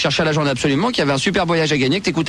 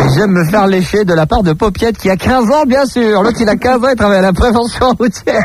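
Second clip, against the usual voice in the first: lightly put-on higher voice; hammed-up, higher-pitched mimicry; mock confession; 4 semitones higher, 7 dB louder than usual. Host parodying a road-safety worker's confession, mock earnest.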